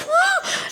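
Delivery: high, sharp imitated gasp; mock freak-out gasp. Parents freaking out over school admission, mock gasp. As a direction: gasp like a frantic parent, laughing. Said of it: "people are freaking out".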